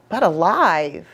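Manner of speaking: ordinary delivery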